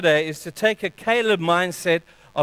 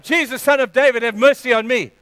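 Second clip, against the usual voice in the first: loud, higher desperate cry; shouting for help; 5 semitones higher, 5 dB louder than usual. Retold or imitated. Blind beggar calling out to Jesus, loud pleading cry. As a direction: call out across the crowd for mercy. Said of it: "he starts to shout out".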